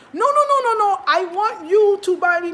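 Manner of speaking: hammed-up auntie refusing to let go; mock auntie shout. Imitating the auntie shouting her demand for bananas, high and loud.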